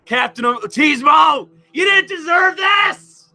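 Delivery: hammed-up high wail, drawn out at the end; mock grieving shout. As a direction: cry out in mock grief over the coffin. Mock funeral tribute to Captain.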